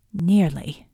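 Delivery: low, falling "Nearly"; dry echo. A narrator's reading. Another character echoes with doubt.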